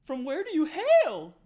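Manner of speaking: very high, loud, squeaky hostess voice; squeaky, loud Darnsky serving the party; eager barmaid. Acted. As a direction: push the drink on them with bubbly certainty. Darnsky asks origin.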